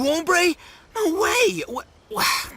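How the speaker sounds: high-pitched, stammering shock; astonished disbelief. Acted. Hearing his friend was conned too, astonished disbelief. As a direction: shoot up high and lose your words.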